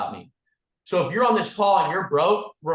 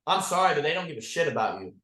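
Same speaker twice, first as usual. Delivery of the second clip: normal talking voice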